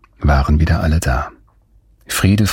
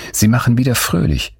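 ordinary delivery, nothing marked

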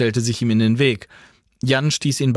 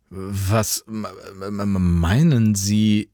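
stuck on the m, then slow; confused stutter, groping for words; bewildered stammer; 2 semitones lower, slower than usual. Acted. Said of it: "stammelte Jan irritiert".

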